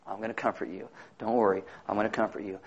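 soft, low, tender reassurance; gentle comforting God. Retold or imitated. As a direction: hush them like a loving father. God voiced comforting the sinner.

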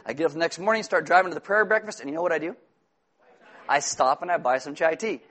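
ordinary talk, nothing marked

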